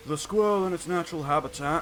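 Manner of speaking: lightly voiced British wildlife narrator; a hint of a hushed documentary voice; nature documentary narrator. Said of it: "David Attenborough, though, the British, nature documentary dude".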